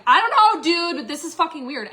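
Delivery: high, loud outburst easing off at the end; overwhelmed, freaked out. Retold or imitated. Telling Jennifer this is weird, freaked out. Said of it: "get really overwhelmed and freaked out".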